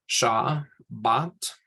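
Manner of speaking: Sha, bat said as two separate syllables with a pause; split into syllables. Shown as a demonstration. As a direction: break Shabbat into Sha and bat. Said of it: "sha, is an open one".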